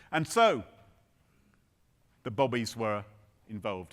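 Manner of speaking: ordinary delivery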